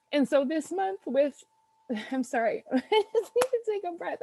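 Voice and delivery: tearful, breaking into nervous laughter, voice climbing high; fighting back tears mid-sentence; choked up. Real, not acted. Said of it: "I get so emotional when I talk".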